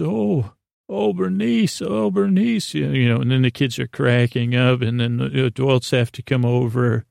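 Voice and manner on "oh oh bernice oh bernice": high, hammed-up cry to Bernice; a clown's mock lament, pitched high; mock clown wail. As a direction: wail over the rubber chicken like a clown. Clown mourns squashed rubber chicken.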